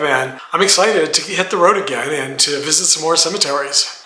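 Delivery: ordinary talk, nothing marked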